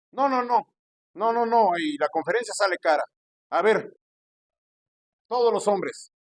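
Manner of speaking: insistent preacher working up a collection; imitated pushy pastor. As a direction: mimic a pastor working the crowd. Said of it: "con ese acento y ese tono de voz".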